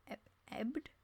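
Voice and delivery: whispered "ebbed?" with a questioning rise; puzzled whisper. Real, not acted. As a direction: breathe "ebbed?" unsure. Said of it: "doubting the word just read".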